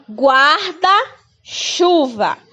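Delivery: guarda-chuva spelled out slowly, syllable by syllable; slow model word. Shown as a demonstration. Teacher slowly modelling a word for pupils. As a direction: draw out guarda-chuva so the R is heard. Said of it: "O R tá, vem antes da letra D".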